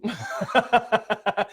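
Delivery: high amazed burst of laughter. Retold or imitated. Recalling meeting Eric Thomas, amazed laugh. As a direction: laugh in stunned delight.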